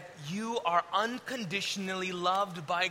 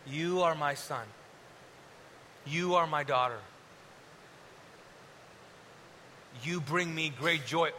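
tender; 5 semitones lower than usual. Acted. God voiced to listener, lower, softer.

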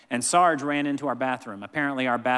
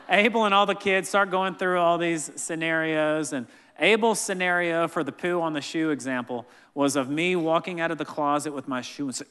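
ordinary delivery

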